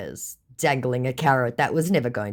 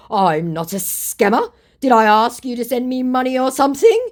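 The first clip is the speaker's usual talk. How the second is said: louder, climbing high into an affronted question; mock outraged denial; indignant protest; 11 semitones higher, 7 dB louder than usual. Accused scammer denying he asked for money, indignant.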